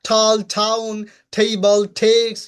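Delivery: ঠাল, টাউন with a strong puff on T; slow, firm drill of ঠাল to টেক্স, aspirated T. Shown as a demonstration. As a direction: model টেবল as "thei-bal". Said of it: "ঠির কিছু word".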